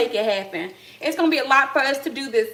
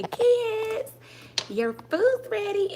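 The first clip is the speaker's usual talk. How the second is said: put-on high, slow call to the kids; 10 semitones higher, much slower than usual. Shown as a demonstration. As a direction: sing out Kids, your food's ready high and drawn out. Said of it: "I'm finna show y'all how I play kids' food and say".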